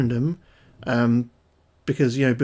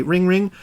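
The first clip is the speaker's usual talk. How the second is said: louder rising "ring, ring"; imitated ringtone; 6 semitones higher, 6 dB louder than usual. Announcing Paul's call, ringtone voiced. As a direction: ring like the phone. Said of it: "when it rings, it says".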